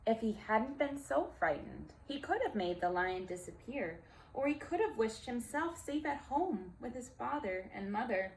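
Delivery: ordinary delivery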